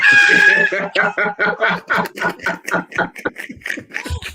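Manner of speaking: long, rising burst of laughter; big laugh. Real, not acted. Laughing hard at her own story. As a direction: cracks up at the punchline.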